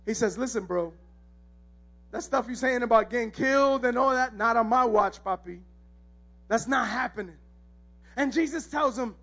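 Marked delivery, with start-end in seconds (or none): 0.0-7.4 confidential objection. Acted. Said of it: "Peter pulls him aside".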